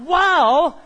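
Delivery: loud, high wow; delighted wow. Retold or imitated. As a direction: gasp at the best chip ever. Tasting the chips again.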